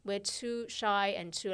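ordinary talk

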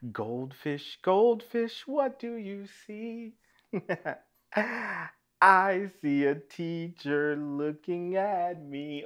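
chanted refrain, leaping high and loud on the repeat; sing-song story reading. Acted. Reader to children with picture book, sing-song chant. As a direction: lilt it musically for little listeners.

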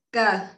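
"cờ" with a tense, tightened initial consonant; taut, stressed "cờ" for a doubled letter. Shown as a demonstration. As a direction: tighten the first sound of "cờ". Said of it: "đọc căng".

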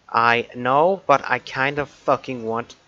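loud, annoyed rant; irritated outburst. Real, not acted. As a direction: snap back, fed up. Angry rant at the viewer.

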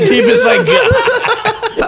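high, strained, laughing. Pretend. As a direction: squeeze it out laughing. Strained joke voice.

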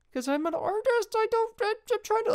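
flustered artist. Pretend. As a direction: pitch up as the overwhelmed creative.

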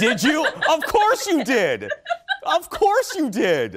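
high, laughing delight; amused, high-pitched exclamation. Real, not acted. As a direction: laugh through the words, pitch jumping up. Host tickled by guest's admission.

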